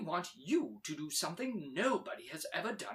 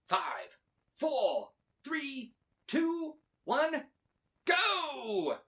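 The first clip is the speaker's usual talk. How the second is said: rising higher and louder to go; building countdown; 8 semitones higher, 4 dB louder, much slower than usual. Acted. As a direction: build the count to a launch cry. Professor counts down launch.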